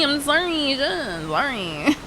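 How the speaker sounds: sorry stretched long and slow; hammed-up slow sorry; slow drawn-out mock apology. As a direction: draw out a slow, exaggerated sorry.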